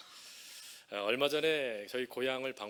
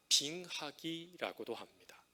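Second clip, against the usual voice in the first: ordinary talk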